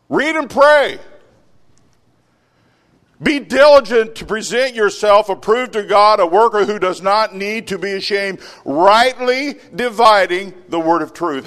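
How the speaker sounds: loud, high preaching; sermon at full force; fiery preaching. Acted. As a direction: press the congregation hard. Preaching scripture reading.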